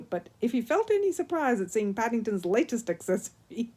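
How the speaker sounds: usual speaking voice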